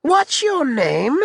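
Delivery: very high, drawn-out question; Alexander. Acted. Lord of war questions the captain, high-pitched. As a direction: ask it slowly in a grating high pitch. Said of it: "He has a high-pitched voice".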